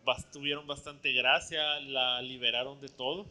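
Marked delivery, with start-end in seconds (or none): none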